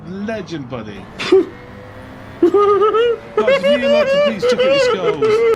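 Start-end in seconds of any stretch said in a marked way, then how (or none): 1.2-5.6 slow, high, loud hype call; excited shout. Real, not acted. Streamer hyping a donation.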